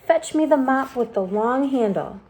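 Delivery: high, bossy disgusted aunt. A narrator's reading. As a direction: order the mop in disgust. Aunt demands mop for spider.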